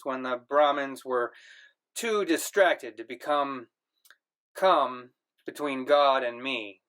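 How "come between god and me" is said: plain reading voice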